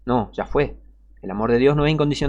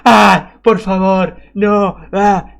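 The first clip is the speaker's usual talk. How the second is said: acted cry of the suffering; 4 semitones higher, 5 dB louder, much slower than usual. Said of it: "después gritan los que sufren".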